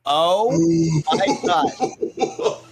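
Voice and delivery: breathy laughing gasp of disbelief; oh my god through a laugh; laughing in shock; amazed laughing. Retold or imitated. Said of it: "I'm like".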